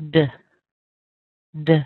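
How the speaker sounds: "d" clipped to a tiny weak uh; reduced schwa syllable. Shown as a demonstration. Teacher showing the reduced first syllable, short and weak. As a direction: scrunch "d" down to almost nothing. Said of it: "you'll end up saying".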